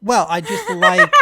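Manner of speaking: loud, slow words through laughter; laughing. Real, not acted. Both joking about "full ass.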